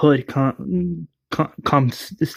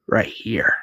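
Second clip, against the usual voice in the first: whispered; 4 semitones lower than usual. Acted. Johnny showing hidden weapons.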